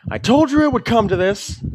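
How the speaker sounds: voice from crowd. Acted. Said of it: "you hear a voice say".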